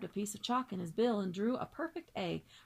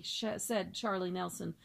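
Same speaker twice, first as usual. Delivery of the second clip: ordinary delivery, nothing marked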